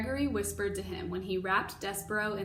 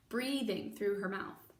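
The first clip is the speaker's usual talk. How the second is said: plain reading voice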